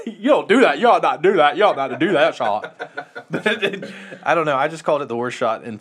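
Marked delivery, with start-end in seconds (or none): 0.1-2.9 laughing, hammed-up scolding; mock scolding. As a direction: scold it like a prissy teacher, giggling. Friends mocking bad shot.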